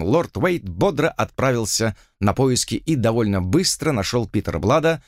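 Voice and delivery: plain reading voice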